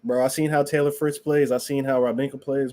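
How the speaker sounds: normal talking voice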